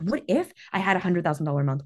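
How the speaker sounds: ordinary talk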